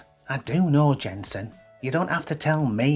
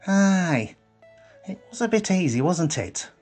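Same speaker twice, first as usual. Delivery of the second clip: higher-pitched, keen agreement; agreeing; 8 semitones higher, 5 dB louder than usual. A narrator's reading. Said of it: "agreed Harry".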